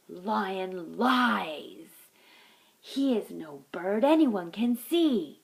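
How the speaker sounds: loud, slow declaration; bold accusation. Acted. The mongoose accuses the lion before the council, loudly. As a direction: call the lion a liar for all to hear.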